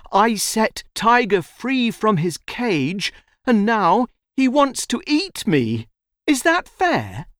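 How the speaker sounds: put-on high-pitched, aggrieved child; young boy appealing. A narrator's reading. Boy asks the donkey to judge, appealing. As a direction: plead your case in a high voice.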